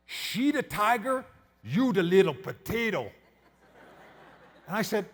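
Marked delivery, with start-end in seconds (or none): 0.1-3.1 slow, deliberate mock broken English; broken English accent. Disgusted guide sizing up wife and husband, broken English accent. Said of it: "real broken English accent".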